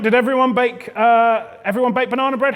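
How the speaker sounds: ordinary delivery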